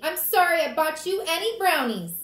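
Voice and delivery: put-on high, loud child's outburst; stomping tantrum. Fudge to his parents after punishment, in a tantrum. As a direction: throw it at them in a temper. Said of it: "stomped his feet".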